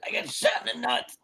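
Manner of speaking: high, very soft pained voice; retold weak complaint. Teller voicing player repeating his injury, mock feeble. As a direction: imitate a hurt man barely getting it out.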